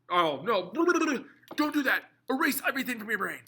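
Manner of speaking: high, loud, flustered outburst; mock panic. Teacher catches his mistake before the class, comic panic. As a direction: blurt it out high to cancel your own slip. Panics at own mix-up of currents.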